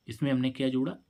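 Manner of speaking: ordinary delivery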